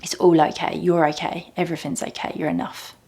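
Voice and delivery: tender, fading to a whisper; gentle reassurance. Shown as a demonstration. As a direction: comfort yourself like a loving parent. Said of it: "those words to myself".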